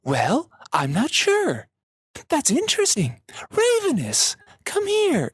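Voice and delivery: very high, wispy voice with an upward trail on the end; high wispy Julian. Retold or imitated. Friend imitates the voice actor playing Julian, high and wispy. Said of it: "he's just like".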